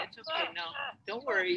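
high, gentle calming words; high soothing voice. Shown as a demonstration. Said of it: "do you wanna s-, charge me?".

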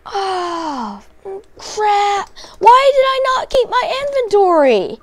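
high, loud anguished cries of no; despairing wail. Real, not acted. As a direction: wail in real despair over lost items. Dies and loses his stuff.

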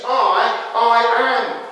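I held long and repeated, then AM; slow, separate stress on I and AM; emphatic I AM. Retold or imitated. Preacher translating ego eimi for the congregation, emphatic I AM. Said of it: "It's very emphatic".

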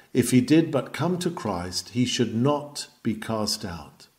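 ordinary talk, nothing marked

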